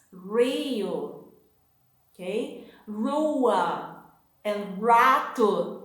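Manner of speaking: Rio, Rua, rato with an English curled R; English-style R. Shown as a demonstration. Teacher imitating how English speakers mispronounce the R. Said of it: "you tend to pronounce something like this".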